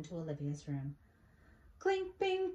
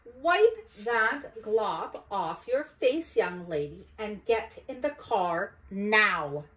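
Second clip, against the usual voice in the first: slow, louder stern mother; stern scolding; 4 semitones higher, 10 dB louder, slower than usual. Acted. Mother ordering Olivia to the car, slow and stern. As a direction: lay down the law.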